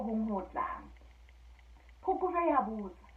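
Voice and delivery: telling a folk tale aloud, lively and even; a storyteller sharing a fairy tale with listeners; story narration. A narrator's reading. Storyteller telling Basotho fairy tale to listeners, lively narration.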